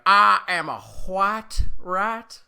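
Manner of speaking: drawn-out, hammed-up Southern accent; southern gentleman accent. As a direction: proudly declaim it like a Southern gentleman. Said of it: "the fact that he describes himself".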